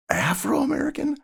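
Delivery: very high-pitched questioning repeat, not shouted; incredulous echo. Retold or imitated. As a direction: echo it back in disbelief. Echoes the shouted term, incredulous.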